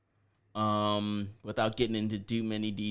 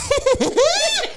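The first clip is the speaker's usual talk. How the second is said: high shrieking laugh; squealing laugh; 10 dB louder than usual. Real, not acted. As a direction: laugh in a shrill falsetto. Laughing while mocking.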